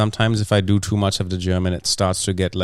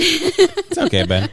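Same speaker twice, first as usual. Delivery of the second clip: much higher, laughing through the words; slower than usual. Real, not acted. To Ben the editor about the long episode, laughing. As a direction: giggle it out, slow.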